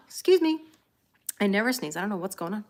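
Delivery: ordinary talk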